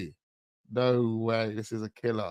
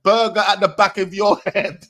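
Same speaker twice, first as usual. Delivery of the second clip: loud, high, gleeful quoting of the line; repeating the lyric loudly; excited quote; 8 semitones higher, 6 dB louder than usual. Retold or imitated. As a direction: shout the line back in disbelief. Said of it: "Man said".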